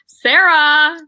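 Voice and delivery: loud, high, drawn-out name; calling out. Real, not acted. Host prodding Sarah about the book club, loud calling out. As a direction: holler the name to prod a friend.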